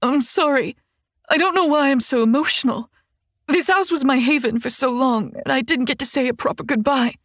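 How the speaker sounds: tearful. Acted. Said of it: "This makes her cry harder".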